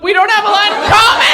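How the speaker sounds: incredulous echo. Real, not acted. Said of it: "repeats the guest's line".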